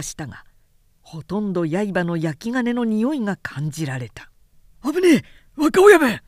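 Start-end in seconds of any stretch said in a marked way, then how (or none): 4.8-6.2 loud, high, urgent cry; warning shout. Acted. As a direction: yell a warning across the roof. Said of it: "権七の声がした".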